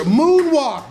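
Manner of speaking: shouting. Real, not acted. Shouting the word for effect.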